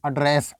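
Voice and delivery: "एड्रेस" opening on a short uh; verb pronunciation. Shown as a demonstration. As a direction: model "एड्रेस" as uh-DRESS. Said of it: "इसको एड्रेस बोलना है". ("एड्रेस" की शुरुआत छोटे "अ" से)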